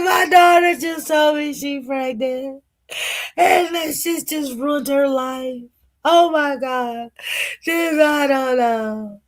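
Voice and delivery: high, drawn-out tearful wail; acted crying. Mother in a motel telling her date about daughter's pregnancy, mock crying. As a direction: wail it high, imitating yourself in tears. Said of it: "I sat there in the motel and just cried".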